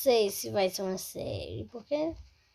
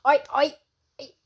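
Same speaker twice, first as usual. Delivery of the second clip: ordinary talk, nothing marked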